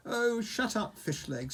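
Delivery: high-pitched, exasperated retort; impatient. A narrator's reading. Hiccup silences his friend Fishlegs, impatient. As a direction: cut him off impatiently. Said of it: "said Hiccup impatiently".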